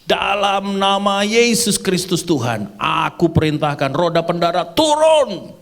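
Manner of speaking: slow, high-pitched commanding shout; shouted prayer. Retold or imitated. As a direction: re-enact a pilot's drawn-out prayer command. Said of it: "Saya teriak tuh". (teriakan doa yang memerintah)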